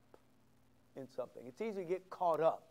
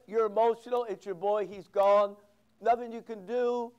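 stern rebuke; 3 semitones higher, 6 dB louder than usual. Acted. Joab's rebuke acted loud.

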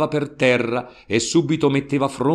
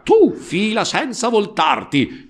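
high, louder order to clear off; a barked command at gunpoint; sharp command; 10 semitones higher, 3 dB louder than usual. Acted. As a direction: bark it like an order. Don Camillo orders Peppone away.

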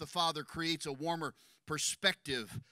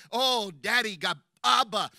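intimate cry; 8 dB louder than usual. Acted. Preacher acting intimate cry to God.

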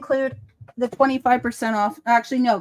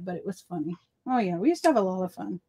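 ordinary talk, nothing marked

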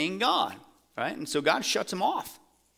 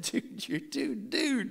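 mock Samuel; 9 semitones higher, 5 dB quieter, much slower than usual. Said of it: "That's [name] translation there".

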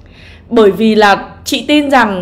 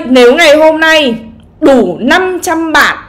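normal talking voice

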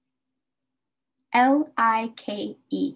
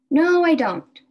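mock student answer; 6 semitones higher, 6 dB louder than usual. Teacher acts student's answer.

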